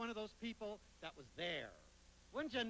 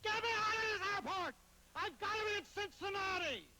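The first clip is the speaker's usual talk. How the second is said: high, loud demanding yell; mock screaming; 14 semitones higher, 4 dB louder than usual. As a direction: imitate a frantic stranded passenger. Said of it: "went around screaming at stewardesses".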